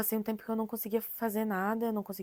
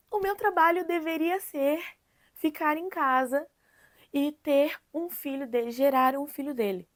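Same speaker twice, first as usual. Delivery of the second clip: raised, higher voice, each phrase set apart; upset and loud, pausing between phrases; upset retelling; 6 semitones higher, 7 dB louder than usual. Retold or imitated. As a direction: repeat his demand louder and higher, visibly upset. Upset retelling husband's demand.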